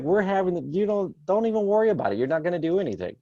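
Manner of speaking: comic brush-off to a sobbing son; mock dismissive reassurance. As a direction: wave off the kid's worry.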